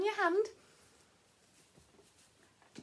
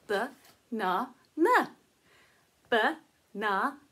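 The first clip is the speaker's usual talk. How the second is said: usual speaking voice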